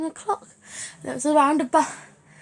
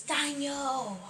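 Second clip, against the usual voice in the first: higher, drawn-out call without real volume; 4 semitones higher than usual. Retold or imitated. Nan calls Daniel in the shower, mock shout. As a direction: imitate a nan calling through the house. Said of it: "my nan shouts".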